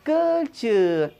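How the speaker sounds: कल्चर stretched slowly as kal-char; careful pronunciation. Shown as a demonstration. Teacher models कल्चर slowly for students. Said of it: "हम क्या कहते हैं?".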